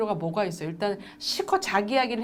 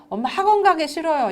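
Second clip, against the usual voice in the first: put-on higher, louder child's protest; whining child; 7 semitones higher, 6 dB louder than usual. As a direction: put on a kid who won't go. Voicing child refusing academy.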